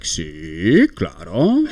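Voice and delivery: low, drawn-out "Sí" sliding up into "claro"; Spanish waiter's tone. Retold or imitated. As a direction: stretch "Sí" low and lift the end, then "claro". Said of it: "like, tones of voice".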